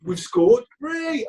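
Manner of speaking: high, punchy mock celebration; retold fan elation. Ticking off fan milestones with glee.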